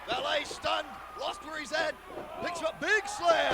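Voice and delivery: excited shout. Acted. Excited wrestling play-by-play.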